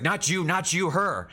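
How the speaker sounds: loud, high-pitched correction; exasperated shout. Acted. Game master as Barnaby pointing at Brenna, shouting in exasperation.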